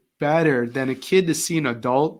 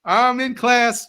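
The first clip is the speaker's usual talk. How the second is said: much higher and louder, a student making a big entrance; imitating a late student playing to the class, loud and showy; showing off; 10 semitones higher, 5 dB louder, slower than usual. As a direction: ham up a show-off student demanding attention. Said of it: "she'd make a big show".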